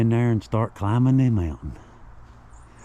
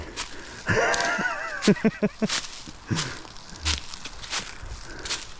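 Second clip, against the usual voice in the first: breathless laugh; 12 semitones higher, 6 dB quieter than usual. Real, not acted. Exhausted climber laughs.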